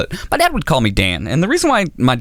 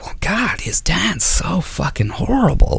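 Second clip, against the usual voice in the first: gasp-like dismay, slow and pitched up; shocked listener; 7 semitones higher, slower than usual. Acted. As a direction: imitate an appalled onlooker. Voicing a shocked listener's reaction.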